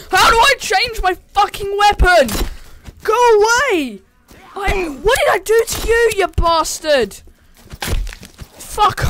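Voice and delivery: high, loud frantic shouts; panicked yelling. Real, not acted. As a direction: yell in a flailing panic. Fighting monster, panicking.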